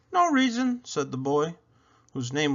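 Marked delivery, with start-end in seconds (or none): 0.1-0.8 higher, lightly voiced young boy; a shy boy, pitched up; boy's voice. A narrator's reading. Boy answers Isabel about his box, higher boy voice.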